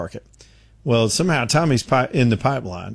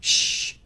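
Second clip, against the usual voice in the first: breathy imitated geyser whoosh; soft whoosh; 5 dB quieter than usual. Shown as a demonstration. Host imitating Old Faithful, soft whoosh. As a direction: voice the quiet rush of steam. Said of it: "it just kinda goes".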